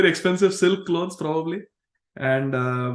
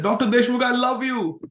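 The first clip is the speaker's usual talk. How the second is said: called out loudly; 8 semitones higher than usual. Retold or imitated. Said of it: "she loudly said".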